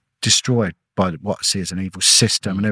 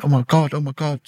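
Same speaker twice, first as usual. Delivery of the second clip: imitated nurse's amazement, pitched higher; retold disbelief; 7 semitones higher than usual. Nurse who can't believe the son came back, retold disbelief. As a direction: mimic an astonished nurse in a high voice. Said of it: "she couldn't believe it".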